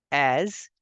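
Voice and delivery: ordinary delivery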